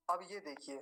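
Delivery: ordinary delivery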